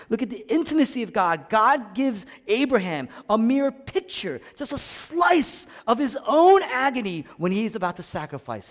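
high-pitched, urgent sermon delivery; fervent pulpit delivery; impassioned preaching. Real, not acted. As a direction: preach it with rising fervour. Preaching at full force.

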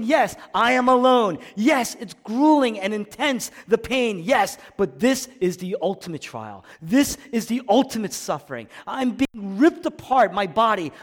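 high, loud anguished outpouring; impassioned suffering, acted at full voice; quaking and weeping. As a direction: pour out the agony in a preacher's cry. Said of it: "He's quaking and he's weeping".

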